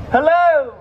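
high, loud drawn-out hail; calling out. Real, not acted. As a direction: call across the street to passers-by. Hailing passers-by to the show.

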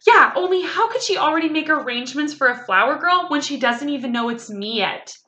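put-on incredulous little kid, high and loud at first; loud childish disbelief, easing off; scoffing at silly grandpa. A narrator's reading. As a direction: scoff as if it's obvious. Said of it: "I laughed out loud at that silly man".

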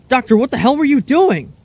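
usual speaking voice

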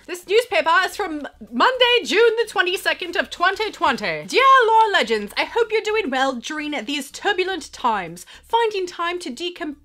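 high, loud, grand mock-English accent; Jane Austen-style English accent. Vlogger reading a fan's formal letter, Austen-era English accent. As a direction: proclaim it in a theatrical old-time English accent. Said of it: "you totally speak like, like, um, the Jane Austen times".

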